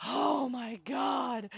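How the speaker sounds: high, slow, drawn-out amazement; awed gasp. Real, not acted. Player reacting to monster's fall, high slow amazement. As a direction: stretch it out in astonishment.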